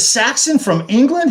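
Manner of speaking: surprised question. Retold or imitated. Young guy asking the stage manager, amazed and pitched high. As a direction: disbelief that it's really them. Retelling his surprised reply.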